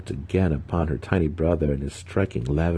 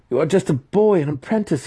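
much higher, louder frightened stammer; stammering fear; 12 semitones higher, 4 dB louder than usual. A narrator's reading. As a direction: plead in panic, caught in a web. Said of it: "he stammered".

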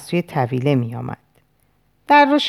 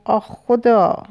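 moaning; 6 semitones higher, slower than usual. A narrator's reading. Sufferer holding the head cries to God, moaning. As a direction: groan to God in discomfort. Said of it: "نالید و نالید و نالید و".

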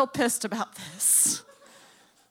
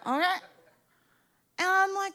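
ordinary talk, nothing marked

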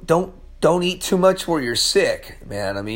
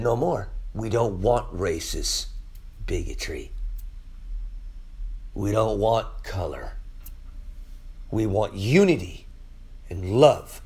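voice of God; 9 semitones lower, 8 dB quieter than usual. Acted. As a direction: speak as God, quiet and grave. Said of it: "But God said".

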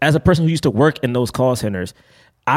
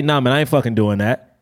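lower, blunt refusal; aggressive deeper voice; 4 semitones lower than usual. Shown as a demonstration. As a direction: push back in your deeper natural voice. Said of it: "that sounds aggressive".

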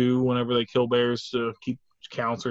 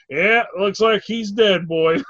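slow, higher lawman voice; Southern deputy drawl; 5 semitones higher, 4 dB louder, slower than usual. Retold or imitated. Deputy standing over the dead bear, slow drawl. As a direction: drawl it like a self-important deputy. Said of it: "He goes".

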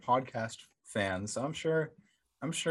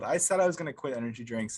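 ordinary delivery

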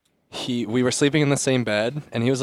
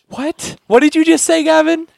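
much higher and louder, startled; shocked question; 7 dB louder than usual. Retold or imitated. To his sleep-talking friend Gavin, a high startled question.

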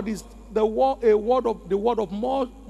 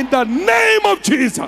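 shouted, higher decree; preaching shout; 7 semitones higher, 7 dB louder than usual. Acted. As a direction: cry it out to the crowd. Preacher decrees over congregation.

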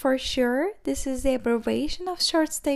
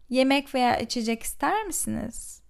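fast; faster than usual. Shown as a demonstration. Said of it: "repeated slowly".

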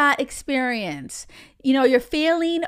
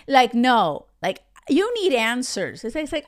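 ordinary delivery

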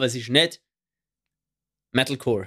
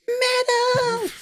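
high shrieked Metal shout; metal scream. Retold or imitated. Shouting at concert to friend.

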